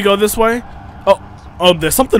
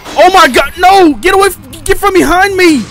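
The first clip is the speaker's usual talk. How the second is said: shrill, very high loud screams; real panic scream; terrified screaming; 13 semitones higher, 7 dB louder than usual. Real, not acted. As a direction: scream in real terror at an ambush. Enemy appears behind player.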